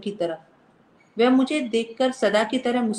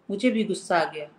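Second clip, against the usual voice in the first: ordinary delivery, nothing marked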